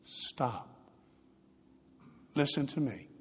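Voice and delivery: calm a crying mother gently but firmly; soft but firm. Retold or imitated. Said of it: "spoke in a very soft but firm voice".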